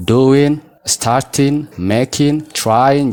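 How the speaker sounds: doing, starting, making, trying all ending on n; silent G. Shown as a demonstration. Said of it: "gaa otoo waamiin". ("doing, starting, making, trying" gaa malee)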